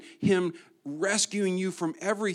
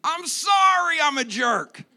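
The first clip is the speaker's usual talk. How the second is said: much higher and louder hammed-up apology; mock sorry spouse; 10 semitones higher, 7 dB louder than usual. Spouse finally admits fault, mocked high and loud. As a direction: ham up a spouse's over-the-top regret. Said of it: "You want your spouse to just be".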